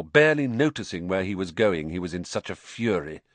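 usual speaking voice

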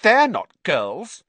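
put-on high young girl voice; girl's voice. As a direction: speak as a young schoolgirl. Hermione voiced.

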